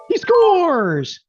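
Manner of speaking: high, loud announcer yell; mock goal call. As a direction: call it like a commentator scoring a goal. Mock sports commentator after "he shoots.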